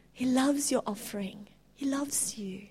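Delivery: whispered preaching. Real, not acted. Preacher to the congregation, in a hushed whisper. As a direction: lean in and whisper the promise.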